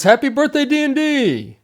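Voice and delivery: high, loud birthday cheer; celebratory greeting. Acted. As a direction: call it out high like a party greeting. Celebratory birthday greeting opens show.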